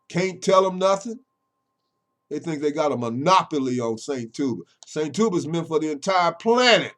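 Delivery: loud, high, driving preacher delivery; full-force preaching. Acted. Preacher warning his critics they'll get it last, preaching at full force. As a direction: preach it hard at the doubters.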